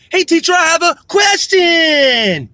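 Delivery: put-on high shouted child, last word dragged out long; mock little cartoon girl. Cartoon girl addresses teacher in class, high mock shout. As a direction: blare the question like an overloud schoolgirl. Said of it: "is her talk like this".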